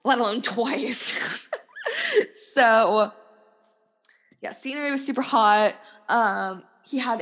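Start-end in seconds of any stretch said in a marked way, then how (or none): 1.2-2.3 laugh. Real, not acted. Laughs at own joke.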